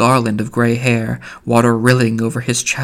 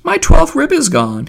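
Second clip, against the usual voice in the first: high, louder mock-dramatic outcry; alarmed cry; 5 dB louder than usual. A narrator's reading. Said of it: "he cried".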